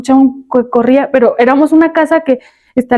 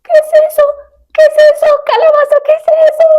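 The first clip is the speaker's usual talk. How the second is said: even higher, squeaky startled pumpkin; faster than usual. Pretend. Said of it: "tienes que hacer voz de calabaza".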